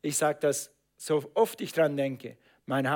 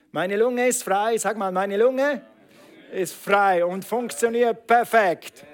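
higher, louder, proclaiming each word; loud declaration; 4 semitones higher, 6 dB louder than usual. Real, not acted. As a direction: declare it boldly to the church. Preacher declares, congregation repeats.

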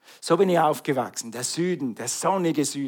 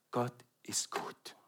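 slow whispered confession; 4 semitones lower, 17 dB quieter, slower than usual. Real, not acted. Preacher to the congregation, a slow whisper. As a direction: drop to a breath. Sudden hushed declaration.

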